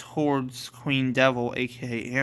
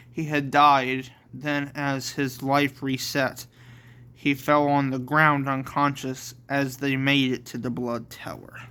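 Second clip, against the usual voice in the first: usual speaking voice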